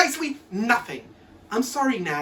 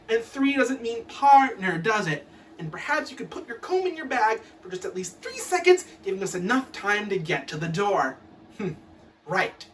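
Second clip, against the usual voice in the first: ordinary delivery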